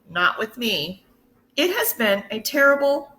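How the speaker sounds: usual speaking voice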